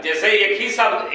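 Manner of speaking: ordinary talk, nothing marked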